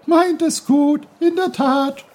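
high, loud, huffy brook voice; brook, annoyed. Acted. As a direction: splutter it back like an offended stream. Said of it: "sprudelte der Bach ärgerlich".

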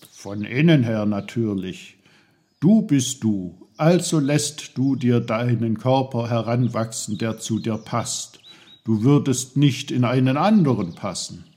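loud, bursting know-it-all voice; a loud, cheeky little helper; know-it-all little uncle, teasing. Acted. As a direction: barge in loudly with a teasing "oh, oh, oh". Voices Onkel Pfefferkorn.

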